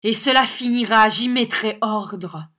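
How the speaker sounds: loud declaration, slowing at the end; forceful vow. A narrator's reading. Young teacher vowing to end the cruelty, forceful vow.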